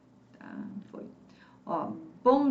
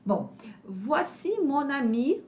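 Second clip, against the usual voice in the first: usual speaking voice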